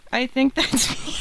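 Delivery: gleeful yelp; excited shout. Real, not acted. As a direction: shout it out laughing. Jokingly volunteering as the sharp woman.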